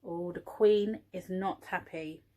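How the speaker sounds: ordinary delivery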